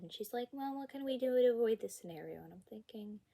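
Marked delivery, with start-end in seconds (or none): 0.5-2.4 higher, louder, quick mock-teacher question; breezy, oblivious teacher. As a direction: imitate a teacher breezing past the problem. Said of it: "like none of this happened".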